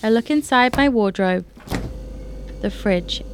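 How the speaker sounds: measured, formal reading of her feature; news article read. Acted. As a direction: read it like a newspaper feature on air. Reads her own story aloud.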